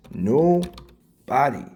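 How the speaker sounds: usual speaking voice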